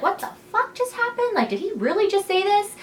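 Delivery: high-pitched, incredulous disbelief; squeaky-high mock astonishment; shocked inner thought. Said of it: "like, in her head".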